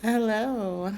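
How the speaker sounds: just-woke-up morning voice; groggy morning voice greeting. Real, not acted. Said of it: "Sorry for my morning voice".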